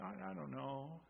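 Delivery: very soft, hesitant little voice; shy. Acted. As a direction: shrink back shyly, barely audible. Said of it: "like, a little shy".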